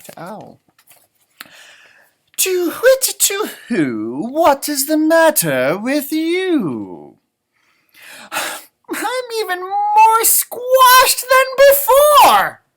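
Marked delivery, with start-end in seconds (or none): 2.4-7.2 hooting, slow owl voice; a slow, hooting wise old owl. Acted. Owl asking Rabbit what's wrong, slow hooting voice. 8.3-12.6 very high, loud, slow mock wail; a rabbit wailing in distress. Rabbit complaining to Owl, high slow mock wail.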